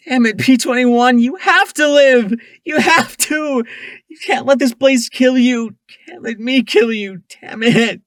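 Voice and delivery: high, loud, breaking into crying; sobbing scream. A narrator's reading. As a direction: scream through tears for him to live. Screaming, sobbing over body.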